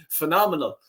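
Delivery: can't keep a straight face at the joke; laughing. Real, not acted. Laughing at coaching staff joke.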